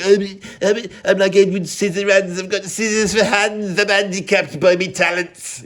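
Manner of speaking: whiny mock-pitiful character voice; hammed-up helpless misfit; Edward Scissorhands. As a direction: put on a feeble, sorry-for-himself act. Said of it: "the Edward Scissorhands, like".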